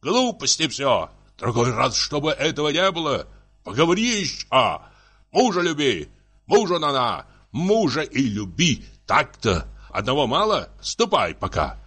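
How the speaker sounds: high, indignant scolding; heated domestic tirade; shouting husband. Acted. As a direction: rant at a wife, pitch pushed up. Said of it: "закричал супруг".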